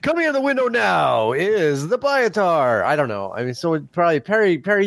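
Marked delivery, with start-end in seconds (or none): 0.0-2.8 mock play-by-play with a laugh; sports commentator. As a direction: call it like a sports announcer.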